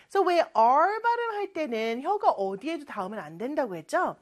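ordinary talk, nothing marked

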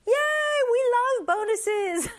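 high-pitched playful cheer; excited cheer. Pretend. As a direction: cheer it like a delighted celebration. Cheering over the bonus example.

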